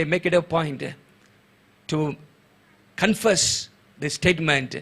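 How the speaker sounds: normal talking voice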